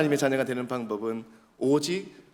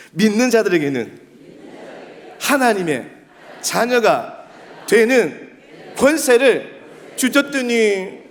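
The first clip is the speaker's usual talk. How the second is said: loud, raised phrases with pauses; call-and-repeat recitation; 8 semitones higher, 7 dB louder, slower than usual. Shown as a demonstration. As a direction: lead a crowd in reciting a verse. Leads congregation repeating a verse.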